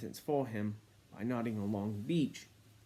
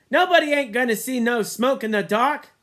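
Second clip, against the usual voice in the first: put-on boy's retort; 10 semitones higher, 11 dB louder than usual. A narrator's reading. Boy voiced.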